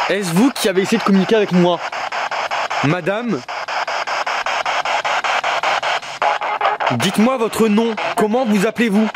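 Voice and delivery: plus aigu et plus fort, rapide au début; appel aux esprits. Real, not acted. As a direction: interpeller l'esprit à voix haute et portée. Appelle un esprit par radio. (voix portée pour appeler une présence invisible)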